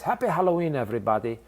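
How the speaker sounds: put-on a hockey player's playful Russian accent greeting fans; quick mock Russian accent holiday greeting. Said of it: "he said it in this Russian accent".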